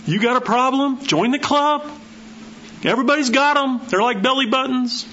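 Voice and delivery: high, lively teasing; bright joking up high; jovial. Acted. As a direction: toss it out playfully high. Preacher jokes everyone has problems.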